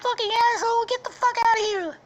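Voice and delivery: very high, loud mock shriek; furious princess. Princess cursing the shooter, furious falsetto yell. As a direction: lash out in an angry falsetto. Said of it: "and then she's like".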